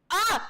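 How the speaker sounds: sudden loud, high shriek; startlingly loud burst of delight; excited yelp. Real, not acted. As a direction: yelp at a lucky find. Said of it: "I was very excited".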